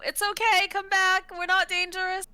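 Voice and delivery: high coaxing call after her. Acted. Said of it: "I'm gonna call out and just say".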